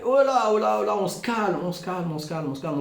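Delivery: calming call. Acted. Calming an overexcited crowd.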